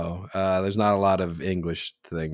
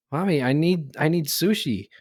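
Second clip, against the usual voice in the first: higher-pitched imitation of a little girl; 6 semitones higher than usual. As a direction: mimic a small child asking mom. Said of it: "there's this little girl sitting there".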